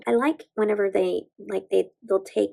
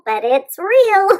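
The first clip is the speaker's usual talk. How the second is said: laughing; 10 semitones higher, 8 dB louder, slower than usual. Real, not acted. Confessing dorkiness, laughing.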